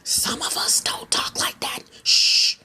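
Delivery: whispered joking aside with a shush; hushed mock confidence; conspiratorial whisper. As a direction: whisper it like a secret. Joking secret aside, then shushing.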